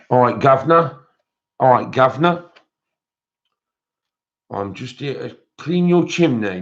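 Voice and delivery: chirpy Cockney chimney sweep, lilting up; hammed-up Cockney greeting; Cockney accent. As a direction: put on a playful Cockney sweep. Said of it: "So that was my Cockney accent".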